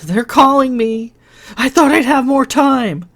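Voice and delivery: high, loud, frightened outburst; Lily terrified she has run out of time; panicked. A narrator's reading. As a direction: blurt it in panic.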